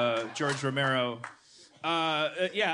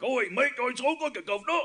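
higher-pitched mock Cockney; bad Cockney accent; 8 semitones higher than usual. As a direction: imitate someone slipping into Cockney. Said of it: "they slip into Cockney".

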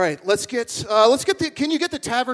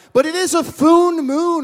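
dramatic; 6 semitones higher, 6 dB louder than usual. Acted. Announcing the foon moon.

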